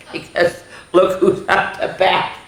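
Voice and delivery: talking through a laugh. Real, not acted. Teacher laughing at the bat picture. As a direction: giggle the words out.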